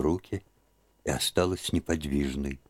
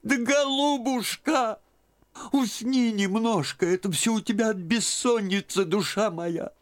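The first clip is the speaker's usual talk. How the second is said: put-on soothing old aunt. A narrator's reading. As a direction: coax her to sleep. Aunt soothes Liza to sleep. (тётка ласково успокаивает Лизу)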